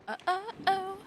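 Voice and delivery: high playful uh-oh; lilting high uh-oh; sing-song uh-oh. Pretend. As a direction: coo it playfully.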